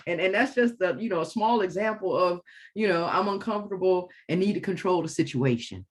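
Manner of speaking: normal talking voice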